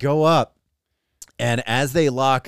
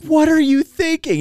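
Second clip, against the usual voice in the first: high, loud disbelief, acted out; mock incredulous scolding; 5 dB louder than usual. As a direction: relive being appalled at a brother. Retold scolding of the brother.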